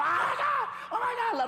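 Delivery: very high, loud mock-panic shriek; panicked screaming coward. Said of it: "se quedan allá gritando".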